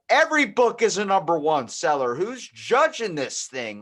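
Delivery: mild mock outrage; exasperated rant. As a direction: scoff at the hype. Ranting about bestseller labels.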